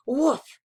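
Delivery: high, loud yip; small dog bark; puppy woof. Acted. Puppy barking at a green snake, yippy woof.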